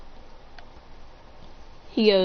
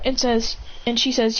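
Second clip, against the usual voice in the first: plain reading voice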